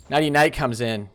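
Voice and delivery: normal talking voice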